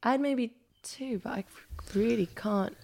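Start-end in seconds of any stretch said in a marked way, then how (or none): none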